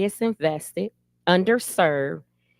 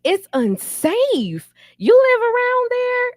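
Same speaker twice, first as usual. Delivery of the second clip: imitating appalled people, rising higher and louder to the end; shocked disapproval; 6 semitones higher, 3 dB louder, slower than usual. Strangers reacting to her neighborhood with mock shock and disgust. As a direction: play the horrified stranger, building to a squeal on the last question. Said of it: "the first thing they say is".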